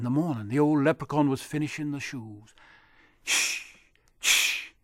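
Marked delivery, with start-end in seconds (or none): 3.2-4.7 shushing. Acted. Hushing while making shoes.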